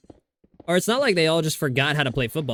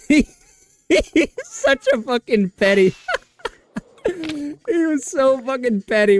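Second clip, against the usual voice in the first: high, laughing through the words; giggling high through the words; 11 semitones higher than usual. Real, not acted. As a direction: crack up while saying it. Cracking up retelling scene.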